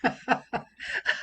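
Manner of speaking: soft, voiceless chuckle; real delighted chuckle; amused laugh. Real, not acted. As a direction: laugh softly at the funny letter. Listener amused by funny letter.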